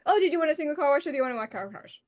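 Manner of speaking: quick attendant patter; fast chirpy sales patter; rattled off fast. Retold or imitated. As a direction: rush the words like a busy car wash attendant. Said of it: "talking so fast".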